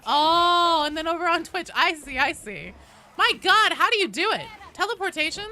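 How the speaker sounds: high, loud playful wonder; mock amazement. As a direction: gush over it in playful awe.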